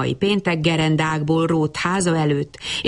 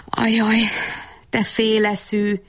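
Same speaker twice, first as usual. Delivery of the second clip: slow, mild self-reproach; self-scolding; 4 semitones higher than usual. A narrator's reading. Bab Berci telling himself off as half-witted, mildly scolding. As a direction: chide yourself with a drawn-out sigh of words. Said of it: "korholta magát".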